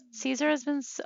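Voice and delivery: high, choked-up start that breaks off; about to cry over her sim, words catch; voice breaking. Real, not acted. Player mourning her sim, voice breaking. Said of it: "oh, I'm gonna cry".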